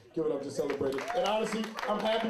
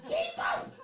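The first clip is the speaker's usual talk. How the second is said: very high shrieked exclamation; exasperated cry. Retold or imitated. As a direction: imitate a mother crying out to heaven. Imitating mother crying out.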